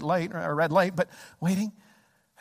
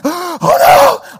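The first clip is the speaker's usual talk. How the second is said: high, loud cry of alarm; alarmed yell; 9 semitones higher, 12 dB louder than usual. Retold or imitated. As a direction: yell out as if about to crash. Acted alarm at near collision.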